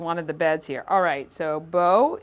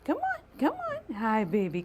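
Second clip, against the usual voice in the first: pet talk voice; 5 semitones higher than usual. Real, not acted. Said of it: "my, uh, pet talk voice".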